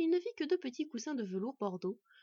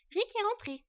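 high, louder female character voice; high-pitched wife announcing news; wife's voice; 12 semitones higher, 6 dB louder than usual. A narrator's reading. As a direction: call the news down to your husband. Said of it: "l'informa sa femme".

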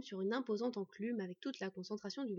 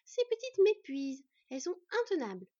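high, loud worn-out complaint; exasperated sigh; 8 semitones higher, 7 dB louder than usual. A narrator's reading. Dwarf woman worn out by the twins, exasperated. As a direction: complain like an overrun mother. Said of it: "soupira la Dvergue".

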